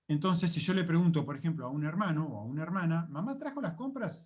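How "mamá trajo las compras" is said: "¿Mamá trajo las compras?" asked high, with "trajo" lifted most; focus on TRAJO. Shown as a demonstration. Teacher asking whether mum brought the shopping, focus on TRAJO. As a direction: ask it with the peak on "trajo". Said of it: "el foco de importancia está en traer".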